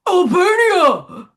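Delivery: high, loud, drawn-out slow cry; shrill pageant shout. Pretend. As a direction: call the country out slowly like a beauty queen on stage. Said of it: "I'll do a different tone and level".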